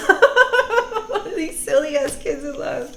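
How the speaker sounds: high giddy laughter through words; helpless high-pitched laughing speech. Real, not acted. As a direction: crack up and repeat it gleefully. Laughs at kids in love.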